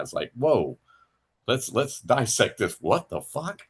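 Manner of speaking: ordinary talk, nothing marked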